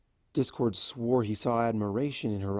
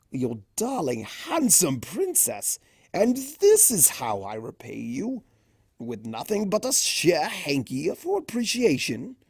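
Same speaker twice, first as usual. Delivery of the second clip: theatrical Discord, swooping higher in mock horror; hammed-up mock lament; 4 semitones higher than usual. A narrator's reading. Discord fussing over shivering Fluttershy in hammed-up mock horror. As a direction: overplay the shame for comedy.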